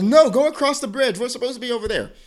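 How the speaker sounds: high mock yell, loudest at the start; imitated yelling. Passenger ordering the driver across the bridge, imitated yelling. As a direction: yell directions like an annoyed passenger, retold. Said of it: "she starts yelling at me".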